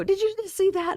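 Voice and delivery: high, incredulous gasp of outrage; shocked outburst. Retold or imitated. Pausing a movie indignantly.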